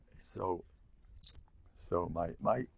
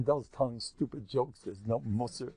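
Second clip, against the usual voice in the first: normal talking voice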